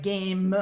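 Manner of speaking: "game" drawn out with an extra final "uh"; sounded final E. Shown as a demonstration. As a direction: wrongly voice the silent E at the end of "game". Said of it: "we don't pronounce that E".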